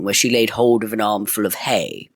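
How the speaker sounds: ordinary delivery, nothing marked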